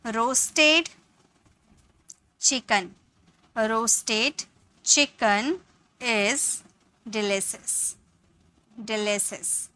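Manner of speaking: very slow, word by word; slow teacher dictation. Shown as a demonstration. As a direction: dictate slowly, pausing between words. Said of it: "आप ये sentences को बोलोगे".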